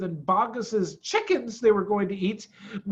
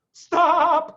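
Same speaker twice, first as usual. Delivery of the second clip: high, loud cry cutting him off; cry of stop; 11 semitones higher, 10 dB louder than usual. A narrator's reading. Badger begging Fox not to tease, loud cry. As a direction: shout him down. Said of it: "cried Badger".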